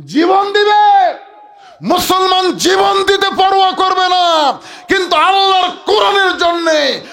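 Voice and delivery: loud, high-pitched sermon cry; fiery preaching. Acted. Preacher rallying the crowd to give their lives, fiery shout. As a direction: shout it out to the crowd, stretching the ends.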